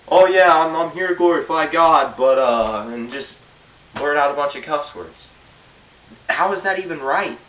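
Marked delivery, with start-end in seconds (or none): 0.1-2.2 loud, high, hammed-up fake piety; mocking hypocrite. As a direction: sneer out a fake-pious boast.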